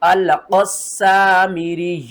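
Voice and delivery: slow chant ending on a pressed stop; firm stop recitation. Shown as a demonstration. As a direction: recite slowly, stop hard on the last letter. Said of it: "सख्ती के साथ वाक़फ़ करेंगे". (सख्ती के साथ वक़्फ़)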